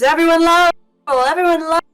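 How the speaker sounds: high, loud, fast rallying cry; screamed cheer. Acted. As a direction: scream it fast like a crowd chant. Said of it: "And he screams".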